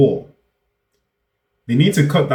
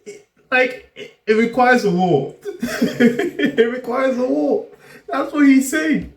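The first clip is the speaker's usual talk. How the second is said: very high-pitched, laughing disbelief; incredulous; 14 semitones higher than usual. Real, not acted. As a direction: squeak it out in amused astonishment. Incredulous laughing reaction to clip.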